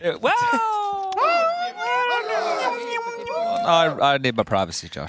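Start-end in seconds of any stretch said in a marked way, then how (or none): 0.4-3.6 long squealing laugh; high laugh. Real, not acted. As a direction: burst into high giggles.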